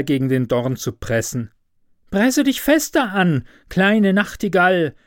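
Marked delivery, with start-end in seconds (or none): 2.1-4.9 high, pressing call of the rose bush; urgent calling bush. A narrator's reading. Rose bush urging the nightingale to press harder, high urgent call. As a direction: urge the little bird on, pitched high. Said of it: "rief der Strauch".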